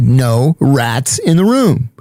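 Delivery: each word laid down slowly; slow, firm refusal. Retold or imitated. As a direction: spell out the rule slowly, no arguing. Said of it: "So I said".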